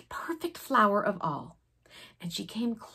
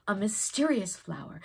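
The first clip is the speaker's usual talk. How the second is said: ordinary delivery, nothing marked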